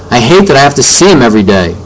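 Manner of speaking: usual speaking voice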